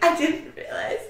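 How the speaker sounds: laughing sarcasm. Retold or imitated. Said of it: "I was like".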